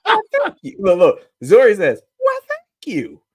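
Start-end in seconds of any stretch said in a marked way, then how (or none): 0.0-0.8 put-on high, loud prim girl voice; a proper little lady; imitating little girl. Father mimics a little girl politely thanking, high prim voice. 2.2-3.2 put-on very high, slow prim girl voice; drawn-out princess thanks; imitating little girl. Father repeats a little girl's prim thanks, very high and slow.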